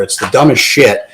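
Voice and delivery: ordinary delivery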